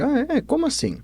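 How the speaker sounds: high-pitched, innocent questioning; feigned surprise. Acted. Master answers his bandaged disciple's accusation, high puzzled surprise.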